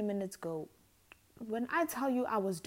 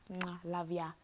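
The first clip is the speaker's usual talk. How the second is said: ordinary talk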